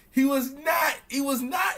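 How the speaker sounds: high, shouted denial; insistent shout. Real, not acted. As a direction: shout him down, no way.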